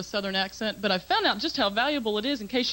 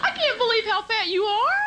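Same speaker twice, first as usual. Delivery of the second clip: sweet Southern belle; 13 semitones higher than usual. Retold or imitated. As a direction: insult a stranger sweetly, with a Southern accent. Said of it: "because of the way they sound".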